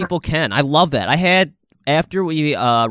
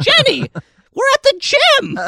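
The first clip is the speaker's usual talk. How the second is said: put-on high shrill old grandma scolding; 4 dB louder than usual. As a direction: squeak it like a scandalized grandma. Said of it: "I go into high of grandma voice".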